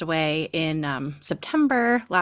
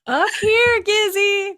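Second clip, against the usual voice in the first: much higher and louder sing-song call; calling to the cat; 14 semitones higher, 6 dB louder, slower than usual. Retold or imitated. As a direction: cry out to the cat, laughing. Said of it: "And then we'd go".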